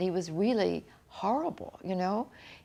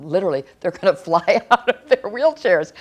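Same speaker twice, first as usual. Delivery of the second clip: loud laughing through the words; real laugh breaking the line; 2 semitones higher, 12 dB louder than usual. Real, not acted. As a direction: burst out laughing at the absurd picture. Imagining parents flying from wheelchairs.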